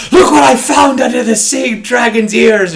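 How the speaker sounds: high, loud hammy pirate captain. Acted. Pirate captain showing off treasure from the sea dragon, hammy high call. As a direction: announce the find like a proud cartoon captain. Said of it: "the pirate captain".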